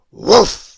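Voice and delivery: big explosive bark of a woof; very loud barked woof. A narrator's reading. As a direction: let out a huge, loud dog woof. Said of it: "didn't go woof like dogs you know".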